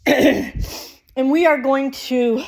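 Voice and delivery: breathless after exercise; panting between words; out of breath. Real, not acted. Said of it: "I'm out of breath".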